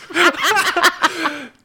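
high burst of laughter. Real, not acted. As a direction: collapse into giggles. Laughs after twister.

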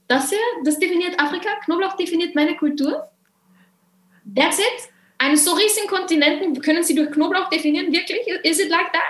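high-pitched, questioning disbelief; mock incredulous challenge. Shopper holding up garlic at the till, mock incredulous.

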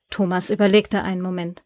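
ordinary delivery, nothing marked

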